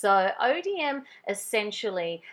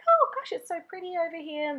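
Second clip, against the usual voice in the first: gushing awe; 11 semitones higher, 4 dB louder than usual. Retold or imitated. Retelling her awe at the view.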